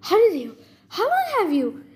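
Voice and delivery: mildly flustered, broken-off questions; stammered. A narrator's reading. Percy questions Annabeth's sudden arrival, lightly stammered. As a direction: stumble into each question and leave it hanging. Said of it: "I stammered".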